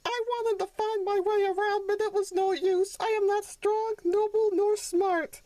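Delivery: put-on high childlike voice, slowing at the end; dejected little donkey. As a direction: confess your failure to mother. Sonny tells mother he failed.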